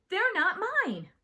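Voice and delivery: loud, high-pitched outburst; angry protest. Acted. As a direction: protest at the top of your voice. Host protests about brother's models.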